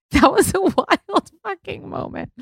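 high, squeaky words through laughter; real laugh spilling into the words. Real, not acted. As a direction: crack up while looking back on it. Laughing over the memory.

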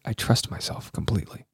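hushed, low whispered affirmation; imitated whisper. Host copying her whispered affirmation, whispering. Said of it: "then she whispered it".

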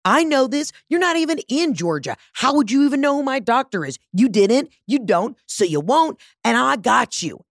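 high-pitched accusing rant, hammed up; acted-out triumphant accusation; indignant gotcha. Acted confrontation with suspected scammer.

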